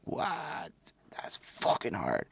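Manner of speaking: hushed, unvoiced whisper. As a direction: breathe it out as a whisper. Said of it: "I was a little like".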